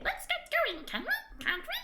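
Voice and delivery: very high, squeaky pleading; impatient sprite. Acted. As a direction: hurry them along like a fidgety fairy. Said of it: "grumbles impatiently".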